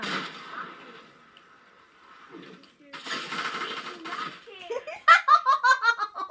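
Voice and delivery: high loud burst of laughter. Real, not acted. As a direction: crack up laughing hard.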